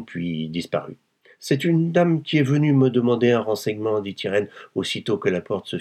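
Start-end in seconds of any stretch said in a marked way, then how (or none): 1.4-4.0 higher-pitched, strained casual excuse; effortful explanation. A narrator's reading. Wife covering up to her husband, strained. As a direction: push out the excuse with your last strength. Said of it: "en mettant dans cette explication le reste de ses forces".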